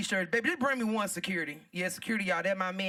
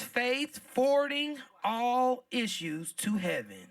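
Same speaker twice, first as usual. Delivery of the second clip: slow reading; 2 semitones higher, slower than usual. Shown as a demonstration. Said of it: "It says".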